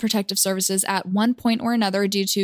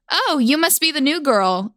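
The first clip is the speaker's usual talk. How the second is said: a light put-on thick accent, pitched up; a hint of a thick drawling accent, raised pitch; 6 semitones higher than usual. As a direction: mimic the neighbor's heavy accent, lightly. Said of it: "in this super thick accent".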